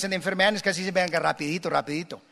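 "rapidito" hurried, dropping soft; rushed mock vows. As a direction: gabble the vows quickly and quietly. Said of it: "casi uno empieza a decirles". (votos a toda prisa, bajando la voz)

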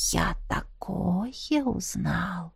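hushed, slow and secretive; conspiratorial whisper. A narrator's reading. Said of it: "заговорщицким шёпотом произнёс друг".

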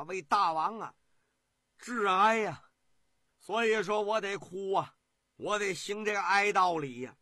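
拉长哭腔，越说越响; 夸张地哭着诉说. Acted. 苏秦哭诉致哀. (苏秦对齐王哭着致哀)

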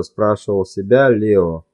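usual speaking voice

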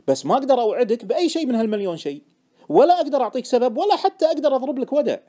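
high, loud, building insistence; exasperated protest. Acted. Trader refusing to promise his friend anything, exasperated. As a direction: build from firm to loudly exasperated.

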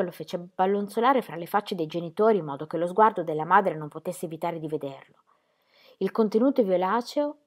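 ordinary delivery

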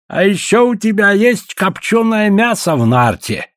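high, brazen demand; unceremonious. A narrator's reading. Guest demanding meat from the trader, unceremonious. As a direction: claim it without any ceremony. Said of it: "бесцеремонно сказал он Чензе".

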